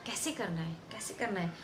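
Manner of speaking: hushed, low self-questioning; low, quiet pondering; musing to oneself. Acted. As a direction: wonder softly under the voice. Actor musing over the role.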